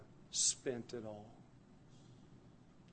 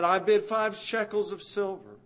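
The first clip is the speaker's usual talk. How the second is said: loud, raised bid call; auction bid; 7 semitones higher, 9 dB louder than usual. Acted. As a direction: call your bid across the market. Bidder at slave auction.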